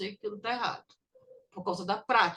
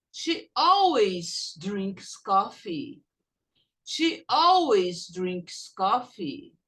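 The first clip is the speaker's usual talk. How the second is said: slow, deliberate reading with stress on always; slow model sentence; 3 semitones lower, much slower than usual. Shown as a demonstration. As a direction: spell it out slowly for the class to follow. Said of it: "Repeat after me".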